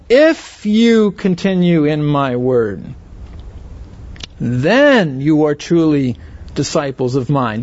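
"If" leaned on, the whole line slow and deliberate; stress on IF. Shown as a demonstration. Preacher reading Jesus' words to the congregation, stressing the condition. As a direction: weigh "If you continue" heavily and slowly. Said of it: "Do you hear that emphasis on".

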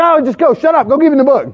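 high-pitched, imitated wifely exasperation; mock impatient wife. Retold wife impatiently ordering husband.

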